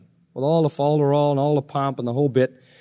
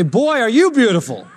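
mock-admiring, higher-pitched gush; sarcastic; 6 semitones higher than usual. Husband to dishevelled wife in the morning, sarcastic. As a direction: gush it, meaning the very opposite. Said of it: "See, that's sarcasm".

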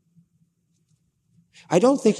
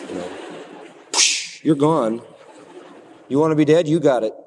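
mock exasperated God; faster than usual. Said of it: "I'd have gone".

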